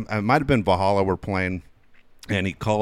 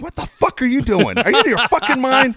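much higher, louder furious yell; losing his mind; 14 semitones higher, 6 dB louder than usual. Retold or imitated. Coach yelling at a kid on his phone, furious. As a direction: blow up at the kid. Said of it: "Mickey loses his mind".

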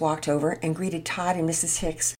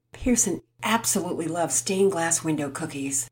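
ordinary delivery, nothing marked

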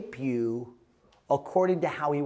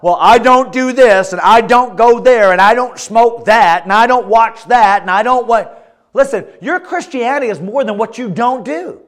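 high, very loud sermon delivery; full-force preaching; 9 semitones higher, 13 dB louder than usual. Acted. Preacher urging teenagers to get busy, full-force preaching. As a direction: drive each phrase home at the top of the voice.

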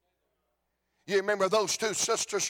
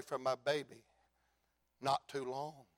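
much softer and lower, choked with tears; tearful father; 8 semitones lower, 10 dB quieter than usual. Acted. Grieving father tells the crowd about his baby, tearful and hushed. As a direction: say it through tears, barely audible. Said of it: "And I can hear him say".

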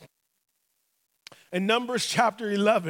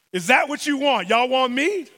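angry challenge; 3 semitones higher, 6 dB louder than usual. Acted. God tired of complaints, loudly challenging them. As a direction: fire the question back in mock outrage.

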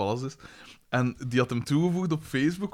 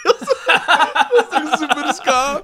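excited exclaim; 8 dB louder than usual. Real, not acted. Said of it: "laughing exclamation".

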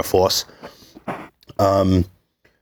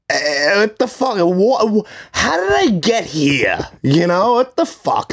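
disbelief; 8 semitones higher than usual. Retold or imitated. His own inner disbelief.

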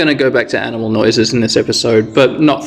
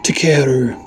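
whisper. Acted. Yamato alone, worried in cave.

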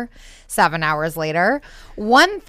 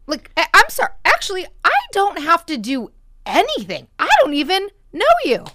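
indignant retort; 8 semitones higher, faster than usual. Pretend. Irritated reply to the stranger.

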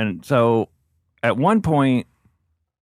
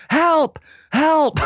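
mock yell. Woman grabbing the ticket yells for help, high mock yell. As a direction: imitate her yelling in a squeal. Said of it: "and she's yelling".

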